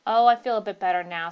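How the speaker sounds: loud, quick, mildly brusque; somewhat brusque. A narrator's reading. Hurst answering how he feels, somewhat brusque. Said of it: "somewhat brusquely".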